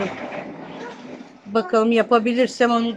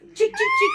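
very high squeaky call; playful calling clicks. Real, not acted. Grandma offers the monkey toy, squeaky high call.

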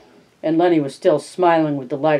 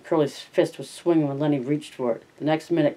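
usual speaking voice

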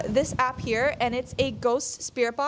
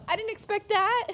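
high, startled voice; stunned ghost hunter; shocked; 8 semitones higher than usual. Real, not acted. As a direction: caught off guard, voice jumps up. Rods moved on their own.

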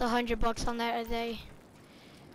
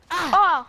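sharp loud yelp of pain; sudden hurt yelp; pained cry; 6 semitones higher, 7 dB louder than usual. Acted. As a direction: cry out as you get hurt. Pained cry after fall.

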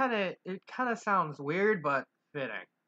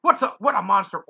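gasped; 2 semitones higher, 7 dB louder than usual. Acted. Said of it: "Owl gasped".

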